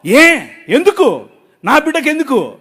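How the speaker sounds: high, loud demanding why; fighting with God. Retold or imitated. Father fighting with God over his baby, high and loud. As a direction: argue hard, pressing each why. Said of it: "నేను కొట్లాడే ఆయనతో".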